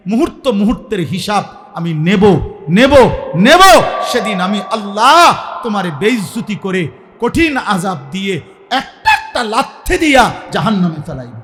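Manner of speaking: preacher roaring God's judgment; thundering threat. Acted. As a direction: hammer each word like a verdict.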